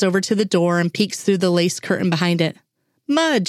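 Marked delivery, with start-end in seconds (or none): 3.1-3.5 high excited call across the room; child yelling to warn her mother; child's yell. A narrator's reading. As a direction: call him out with a child's glee. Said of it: "she yells".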